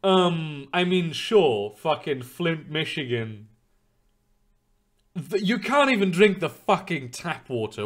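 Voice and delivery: usual speaking voice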